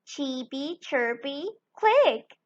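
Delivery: ordinary delivery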